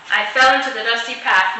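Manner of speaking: usual speaking voice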